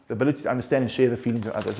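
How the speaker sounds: quick restatement. Shown as a demonstration. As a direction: rattle the definition back off quickly. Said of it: "read the word of empathy".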